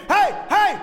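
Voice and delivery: loud, high calls for attention; screaming out. Acted. Disciples in the boat spotting Jesus, screaming to him. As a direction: call out across the water. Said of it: "they start screaming".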